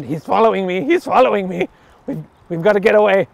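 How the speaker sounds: high, loud mock alarm; hammed-up panic. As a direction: play up being chased, voice jumping up. Mock alarm at being followed.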